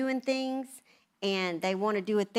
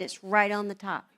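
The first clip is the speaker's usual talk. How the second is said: ordinary delivery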